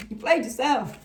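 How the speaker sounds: loud gloating taunt at the masculine. Real, not acted. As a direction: rub it in loudly. Taunting the masculine, laughs after.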